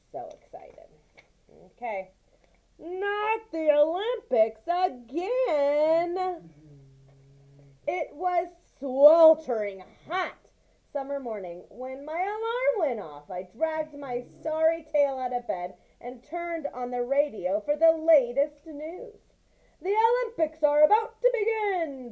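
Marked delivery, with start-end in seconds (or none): 2.8-19.2 lively, raised read-aloud voice for the mouse tale; story narration. A narrator's reading. 19.8-22.1 high, loud announcer bellow; radio announcer shouting. Acted. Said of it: "the radio announcer shouted".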